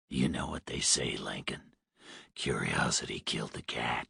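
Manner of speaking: low, hoarse, quiet menace; hoarse smoker's voice. A narrator's reading. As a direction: rasp it like a heavy smoker. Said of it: "The man sounded hoarse, like a heavy smoker".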